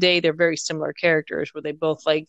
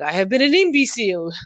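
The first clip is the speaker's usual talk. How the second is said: imitating Poirot in a French accent; mock-French detective exclamation; 3 semitones higher than usual. Woman quotes Miss Marple's Poirot moment, in a put-on French accent. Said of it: "with a French accent".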